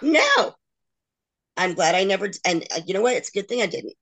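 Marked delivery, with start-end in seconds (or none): 0.0-0.5 a sharp, high, loud no; a real, defiant refusal; emphatic no. Real, not acted. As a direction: slam the door on it with one word. Flat refusal to sign.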